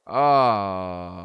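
long groan, loud then fading; exasperated groan. Real, not acted. Player stuck with his own harsh regime, exasperated groan.

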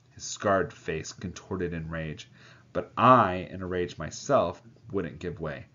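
plain reading voice

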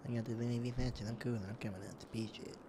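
soft, mumbled parody of his brag; mock quiet imitation of the friend; mocking echo. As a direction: mimic him under your breath. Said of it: "mocking echo of friend's brag".

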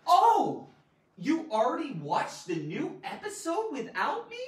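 gasping, high and loud surprise; surprised. Shown as a demonstration. Teacher acting out surprise about a watched episode. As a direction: gasp like you've just been betrayed.

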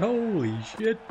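"Holy shit." yelled high and loud, rising; excited shout. Real, not acted. Gamer stunned by the clean bowled, high loud shout. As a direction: shriek "Holy shit." in disbelief. First reaction to wicket.

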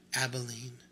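usual speaking voice

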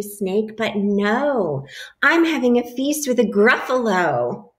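lively picture-book read-aloud; animated storybook voice for a class; reading to children. A narrator's reading. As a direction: read it like story time for little kids.

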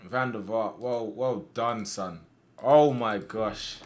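ordinary delivery

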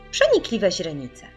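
usual speaking voice

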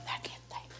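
whispered who's-there, breath only; a secretive whisper toward the door; hushed whisper. Real, not acted. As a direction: whisper so nobody hears.

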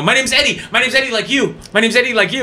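high, over-eager plant in the crowd; weird crazed fan. Retold or imitated. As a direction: blurt it like something's up with you. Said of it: "I'm, like, doing it weird".

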